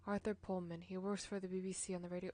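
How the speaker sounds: ordinary talk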